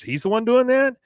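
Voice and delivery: high mock disbelief; surprised. Tid Powell surprised at Joseph, high pitched. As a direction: echo it back, high and amazed. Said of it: "She goes".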